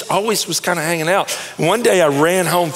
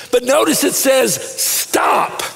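"Stop" pulled out as a hushed high word; stress on stop; 11 semitones higher, slower than usual. Shown as a demonstration. Pastor parsing the motto for the neighbor, "Stop" held apart. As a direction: set "Stop" apart, breathy and drawn out. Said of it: "Well, listen to it".

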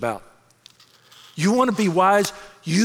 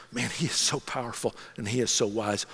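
low, soft, reverent wonder; hushed awe; 8 semitones lower, 8 dB quieter than usual. Acted. Believer marvelling to himself at God, hushed awe. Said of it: "even with the sense of awe".

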